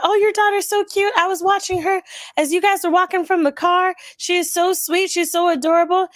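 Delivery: high, gushing compliment; bubbly. Retold or imitated. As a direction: imitate a bubbly stranger cooing over a child. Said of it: "She's one of those bubbly people".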